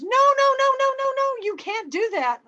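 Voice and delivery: retold gas attendant yelling to stop; angry shout. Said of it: "kinda angry".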